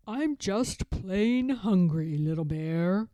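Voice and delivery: put-on a gentle old bear showing an old photo; Grandfather Bear. Grandfather Bear showing his grandson a photo, fond old bear voice.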